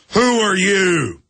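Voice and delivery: slow, pushed, suspicious cop; drawn-out cop demand; mock gruff trooper. Trooper's gruff question, acted.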